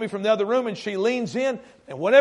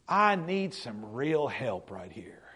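slow, breathy whisper to God; barely voicing a desperate prayer; whispered plea; 9 semitones lower, 11 dB quieter, slower than usual. Pastor whispering a prayer driving to a grieving family.

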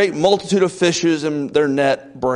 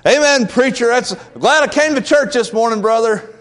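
tongue-in-cheek congregant; 7 semitones higher, 3 dB louder than usual. Pretend. Mock congregant praising the preacher.

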